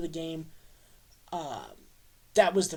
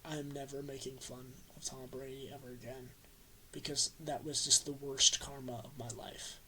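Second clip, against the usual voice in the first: low, much softer, sheepish confession; sheepish quiet quote; 4 semitones lower, 11 dB quieter than usual. Retold or imitated. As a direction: mumble it like a humbled brother. Brother admitting defeat.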